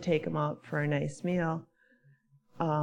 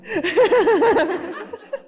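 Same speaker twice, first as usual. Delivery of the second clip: high, loud burst of laughter; real laugh; 13 semitones higher, 7 dB louder than usual. Real, not acted. Listener who liked that one, high loud laughing. Amused after liking the story.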